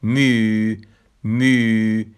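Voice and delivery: teacher modelling the suffix می، carefully; suffix demo. Shown as a demonstration. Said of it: "اونی که یو با نقطه داره".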